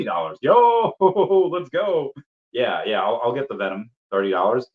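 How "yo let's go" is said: real excited yell, laughing; hyped shout. Real, not acted. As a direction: burst out cheering at the price. Cheap Venom price, hyped.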